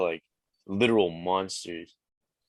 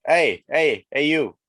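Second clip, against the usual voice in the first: loud, higher attention-getting call; calling someone; 6 semitones higher, 7 dB louder, slower than usual. Shown as a demonstration. Canadian showing the host how to hail someone, calling out. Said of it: "call someone".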